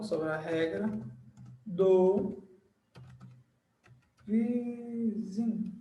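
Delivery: word by word, long pauses, last word drawn out; slow dictation. Shown as a demonstration. Teacher writing the rule's title on the board, dictating slowly. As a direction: dictate it softly while writing, drawing out words.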